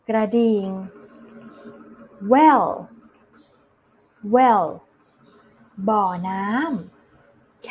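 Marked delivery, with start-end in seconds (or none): 2.3-4.7 "well" louder, as a separate word; slow, clear model. Shown as a demonstration. Teacher models "well" for children to repeat, slow and clear. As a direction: pronounce "well" distinctly with a falling tune. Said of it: "อ่านตามพี่ช้าๆช้าๆชัดๆ".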